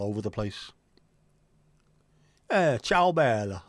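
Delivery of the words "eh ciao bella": slow, louder Italian chat-up line; Eh, ciao bella drawn out like a gondolier flirting; Italian accent. Retold or imitated. Mimicking a gondolier flirting with women, Italian accent. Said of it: "that accent".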